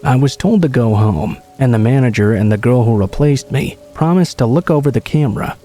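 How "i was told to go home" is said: ordinary delivery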